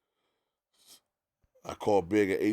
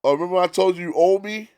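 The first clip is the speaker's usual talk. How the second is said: gloating; 11 semitones higher, 9 dB louder than usual. Retold or imitated. Puff gloating retold.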